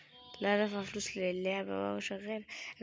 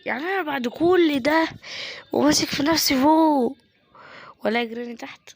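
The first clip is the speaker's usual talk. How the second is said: loud, higher outraged complaint; frustrated yelling; 4 semitones higher, 11 dB louder than usual. Real, not acted. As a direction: rant at the game at full volume. Trapped, frustrated rant. (زعيق غيظ من اللعبة)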